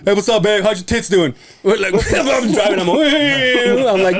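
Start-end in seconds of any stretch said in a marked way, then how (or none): 0.0-1.3 high, hammed-up bro voice imitating him; friend's crude phone greeting, high mock bro voice; mock sleazy greeting. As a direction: play the sleazy buddy, lightly mocking.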